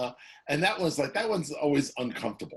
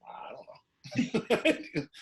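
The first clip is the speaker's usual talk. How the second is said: feigned ignorance; much slower than usual. Retold or imitated. Dad answering son, feigned.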